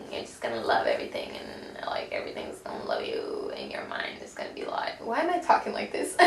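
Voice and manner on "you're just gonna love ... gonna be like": flat robotic whisper. Pretend. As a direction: speak like a robot, monotone. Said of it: "Very robotic voice".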